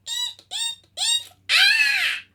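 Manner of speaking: very high monkey shrieks, ending loud; screaming monkey. Acted. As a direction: screech like a monkey. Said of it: "screamed the monkey".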